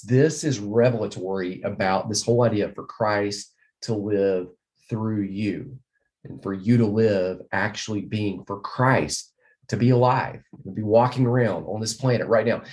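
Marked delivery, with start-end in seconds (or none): none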